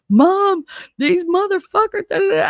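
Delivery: high, loud mock outrage of a kid ranting; mimicking an indignant phone rant; imitated upset son. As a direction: complain loudly to mom, high-pitched. Her upset son's phone calls.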